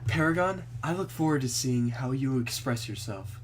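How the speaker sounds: put-on soft low girl voice. Club president's soft line.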